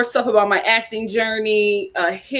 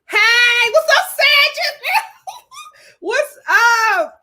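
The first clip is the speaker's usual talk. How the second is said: excited greeting; 13 semitones higher, slower than usual. Real, not acted. Greeting fellow Sagittarius viewers, high laughing hype. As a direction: hype them up with a squeal.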